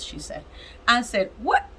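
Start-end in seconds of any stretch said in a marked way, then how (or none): 1.4-1.8 retold disbelief. Retelling her disbelief at news.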